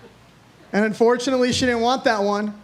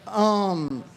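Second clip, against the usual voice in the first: ordinary talk, nothing marked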